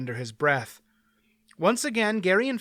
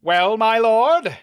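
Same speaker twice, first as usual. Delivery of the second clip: bright, louder, drawn-out greeting; cheerful; 7 semitones higher, 8 dB louder, slower than usual. A narrator's reading. Knight cheerfully invites the next baron to fight. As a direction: cheerfully goad the pale baron. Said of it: "he said cheerfully".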